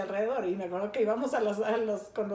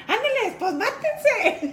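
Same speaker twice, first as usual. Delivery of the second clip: high laughing egging-on; giggling "go on then, kill each other"; 10 semitones higher, 6 dB louder, much slower than usual. Real, not acted. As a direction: laugh it out while egging them on. Laughing at sisters' fight story.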